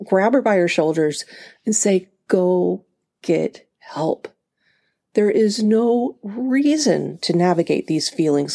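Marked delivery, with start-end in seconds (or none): 2.3-4.3 slow, each word pressed; urgent plea. Retold or imitated. Telling her overwhelmed younger self, slow and insistent.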